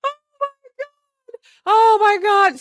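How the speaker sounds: high, wheezing laugh-cry; real laugh-crying; crying with laughter. Real, not acted. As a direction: gasp through helpless laughter.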